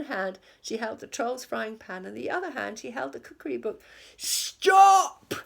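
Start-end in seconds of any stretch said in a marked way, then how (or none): 4.2-5.3 high, very loud "Stop!"; a captain halting the crew; shout. A narrator's reading. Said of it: "called Hank Chief".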